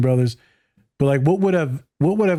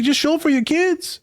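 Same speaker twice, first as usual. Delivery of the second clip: much higher, earnest imitation of a loving dad; imitating the podcast dad; slower than usual. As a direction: pitch way up and plead simply. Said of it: "He's like".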